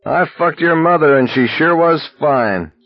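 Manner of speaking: cried out. A narrator's reading. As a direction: hurl it at the soldiers. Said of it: "Scram cried".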